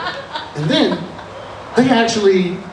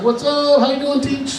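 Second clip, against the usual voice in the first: higher-pitched breezy swagger; casual, showboating hello; cocky greeting; 9 semitones higher than usual. Acted. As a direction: stroll in too familiar with the teacher. Acts himself strolling into parent night.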